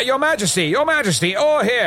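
high-pitched flustered hail; urgent calling out. Acted. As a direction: call urgently for the king's attention. Calling to get the king's attention.

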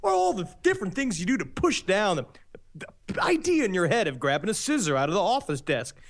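swooping, over-keen commercial read; put-on pitchman patter; spoof ad pitchman. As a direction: sell it like a cheesy TV spokesman.

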